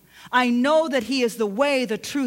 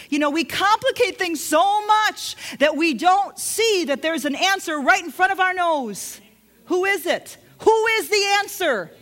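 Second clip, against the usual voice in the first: higher and louder, punching the words; fervent preaching; 7 semitones higher, 4 dB louder than usual. Acted. Preacher to congregation about Jesus the answer, fervent preaching. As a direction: build the sermon to a passionate peak.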